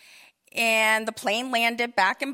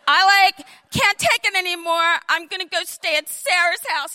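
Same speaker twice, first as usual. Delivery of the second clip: high, loud exasperated whine; daughter fed up; 5 semitones higher, 5 dB louder than usual. Retold or imitated. Daughter storming out to a friend's house, loud exasperated. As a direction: wail it like a fed-up girl. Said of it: "she goes".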